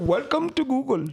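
high put-on Indian accent. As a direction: greet in a mock Indian accent. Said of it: "got another accent for him".

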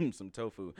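choking cough mid-sentence; coughing through a laugh; coughing up water. Real, not acted. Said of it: "went down the wrong pipe".

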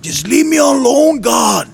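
high, loud angry shout; mad shout. Retold or imitated. Angry man shouting at God to leave him, high and loud. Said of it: "I started to get more mad".

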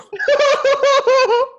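high burst of laughter. Real, not acted. Lavender mix-up.

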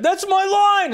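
high, loud hammed-up yell of "That's my line!"; mock excited reactor. Host mocking a fellow reactor's outburst, hammed-up high yell.

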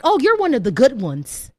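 lightly voiced condescending praise. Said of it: "You might hear things like".

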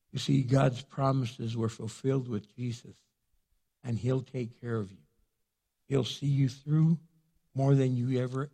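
usual speaking voice

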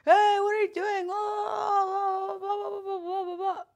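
high, loud mock yell trailing to blah blah; mock police shouting; imitated police yelling. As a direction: shout like angry officers. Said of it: "start yelling at me".

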